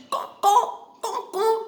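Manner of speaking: high cawing like a crow. Retold or imitated. Teacher imitating the crow for students, high cawing. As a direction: caw it out like a crow. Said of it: "You know what is the sound of crow?".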